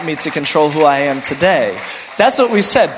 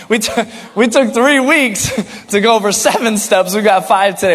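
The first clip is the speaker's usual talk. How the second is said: laughing through the words, a bit louder; 3 semitones higher, 4 dB louder than usual. Real, not acted. Pastor joking with congregation, laughing as he talks. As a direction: chuckle as you admit it.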